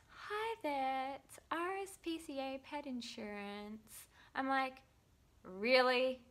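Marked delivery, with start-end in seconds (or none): 0.1-4.1 high, sing-out phone greeting; mock insurance caller. Pet insurance rings her next morning, mock high caller voice. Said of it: "My phone rings". 5.4-6.3 loud, higher incredulous retort; mock disbelief. Answering the insurance company's call, loudly incredulous. Acted reaction to unwanted sales call.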